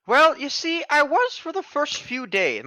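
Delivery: put-on high, slow old woman voice; old lady voice. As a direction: lean into the creaky granny. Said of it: "My old lady voice is sneaking in here".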